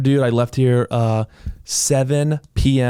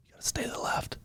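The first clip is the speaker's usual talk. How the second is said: whisper; 17 dB quieter than usual. Real, not acted. Whispered aside.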